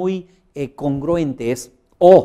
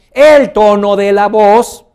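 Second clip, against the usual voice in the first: much louder and higher, slowed down; booming emphasis; 7 semitones higher, 12 dB louder, slower than usual. Shown as a demonstration. Coach demonstrating voice power to the audience. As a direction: project el tono de la voz like a speaker on stage. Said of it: "subo un poco la voz".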